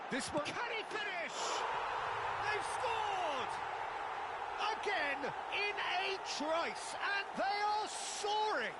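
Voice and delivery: high commentator's shout with long, drawn-out words; excited goal call. Real, not acted. Commentator calling the second goal to viewers, thrilled shouting. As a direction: build from urgent question to soaring, stretched cries.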